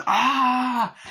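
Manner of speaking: very high, loud mock shriek; imitated scream. [name] and [name] running in, high mock scream.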